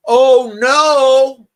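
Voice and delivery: big loud, high cry of dismay; drawn-out, high theatrical outcry; mock alarm. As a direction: wail it out in hammed-up alarm. Mock alarm at story splitting.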